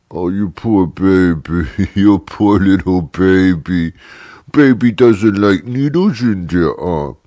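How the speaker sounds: slow, mock baby-talk coo; slow sarcastic baby voice. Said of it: "Why are you talking like that?".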